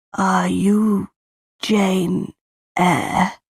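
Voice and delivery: halting words of a dying aunt; very slowly. A narrator's reading. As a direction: drag each word out slowly to her niece. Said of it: "She spoke very slowly".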